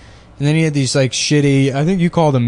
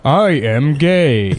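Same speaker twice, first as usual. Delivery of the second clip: slow, drawn-out celebrity impression; George Takei; 2 semitones higher, much slower than usual. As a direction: stretch every word like a hammed-up celebrity. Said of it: "not a particularly good George Takei".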